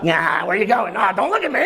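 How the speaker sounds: hammed-up surly driver brushing off the rider; furtive getaway robber. Uber driver avoiding talk with a rider, jumpy and curt. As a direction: act like you just robbed a bank. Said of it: "act like he just fucking robbed a bank".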